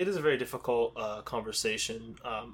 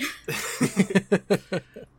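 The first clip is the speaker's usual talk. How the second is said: loud, high real laugh; burst of laughter; 8 semitones higher, 6 dB louder than usual. Real, not acted. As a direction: let a big laugh burst out. Cohost laughs at nerd joke.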